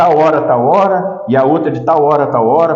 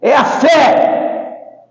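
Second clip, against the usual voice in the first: higher, ringing sermon voice with stretched key words; emphatic preaching; 8 semitones higher, slower than usual. Acted. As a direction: drive the point home to the congregation. Preaches with raised pitch.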